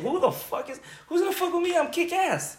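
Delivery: high, cocky mock swagger; mock tough bravado. Recalling his indignant past self, high cocky bravado. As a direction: puff up like nobody would dare.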